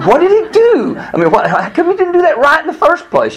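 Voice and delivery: very high, incredulous protest with a laugh; exasperated. Retold or imitated. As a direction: relive the outraged second-guessing. Retelling his angry thoughts about doctors.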